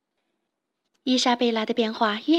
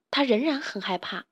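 plain reading voice

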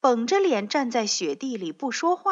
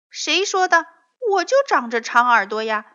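high, loud, fast retort; indignant protest; 6 semitones higher, 9 dB louder, faster than usual. A narrator's reading. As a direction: defend his long ears. Arguing about ears.

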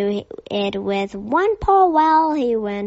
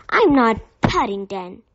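ordinary delivery

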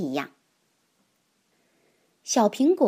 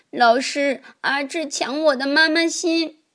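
put-on higher tearful child's complaint; crying child; 6 semitones higher, 4 dB louder than usual. A narrator's reading. Children crying to the teacher about Azhi, high and tearful. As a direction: tattle through tears like a small kid. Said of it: "哭着说".

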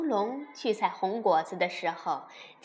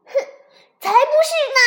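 shouting together; 13 dB louder, much faster than usual. Acted. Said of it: "另外四只甲龙宝宝大喊起来".

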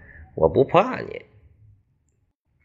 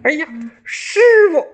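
much higher, louder cry of Shifu; calling out; 7 dB louder than usual. Acted. Beaten apprentice spots his master passing, calls out high and loud. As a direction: shout across the woods to the master.

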